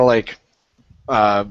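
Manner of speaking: ordinary delivery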